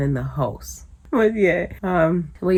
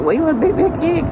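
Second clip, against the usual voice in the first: surprised; 11 semitones higher, 7 dB louder, much faster than usual. Retold or imitated. Mother's retold surprised reply.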